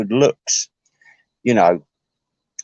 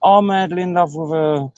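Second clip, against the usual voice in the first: higher, mock-swooning gush; lightly voiced smitten man, hammed-up; imitating a besotted friend, higher and gushing; 6 semitones higher than usual. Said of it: "And he says".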